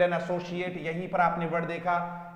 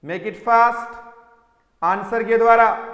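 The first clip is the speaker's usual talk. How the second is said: urgent loud call; 3 semitones higher, 9 dB louder, slower than usual. Real, not acted. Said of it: "teacher urging class to answer".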